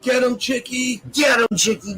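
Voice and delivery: shouting encouragement at the screen; cheering. Real, not acted. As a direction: yell it like a fan cheering. Cheering on a video fight.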